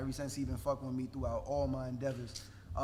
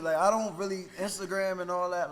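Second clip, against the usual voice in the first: emphatic; 5 semitones higher, 7 dB louder than usual. Real, not acted. Emphatic, louder insistence.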